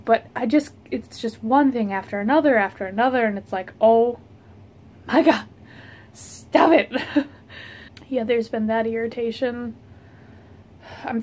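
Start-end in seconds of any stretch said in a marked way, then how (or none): 3.8-7.3 exasperated. Real, not acted. Exasperated by endless tasks.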